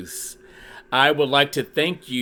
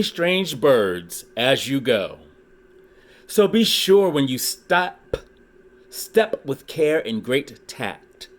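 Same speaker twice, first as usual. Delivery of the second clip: lively read-aloud with rhyming bounce; storybook reading; 3 semitones higher than usual. Acted. Reading a Dr. Seuss book to his YouTube viewers, lively storytelling. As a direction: read it like a children's picture book.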